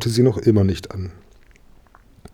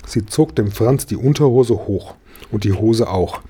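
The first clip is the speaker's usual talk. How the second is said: usual speaking voice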